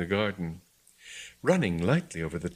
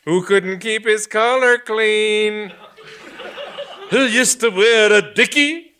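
mocking taunt; 13 semitones higher, 9 dB louder, slower than usual.

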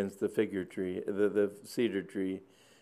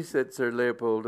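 plain reading voice

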